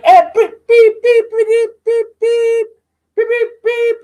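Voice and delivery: high, loud bleeped rant; mock a string of censor beeps. Teen's rant bleeped out in front of classes, loud high beeps. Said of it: "I'm like".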